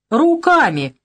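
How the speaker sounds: loud mocking reply; smirking. The henchman mocking the frightened man, smirking. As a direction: toss it back with a sneer. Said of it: "усмехнулся тот".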